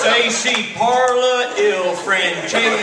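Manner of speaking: loud, drawn-out Italian with an Irish lilt; mock a botched Irish-tinged Italian; mangled Italian accent. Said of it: "Italian with an Irish accent".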